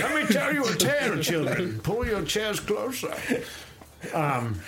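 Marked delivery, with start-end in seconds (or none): 0.0-3.1 put-on loom over the children like a masked villain; Bane voice. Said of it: "turned into Bane there for a minute".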